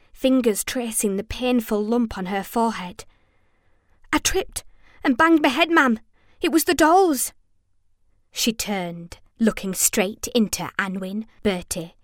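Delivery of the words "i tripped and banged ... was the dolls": high, small, shaken explanation; raised-pitch child, faltering at first; weak. A narrator's reading. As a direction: explain shakily to mother.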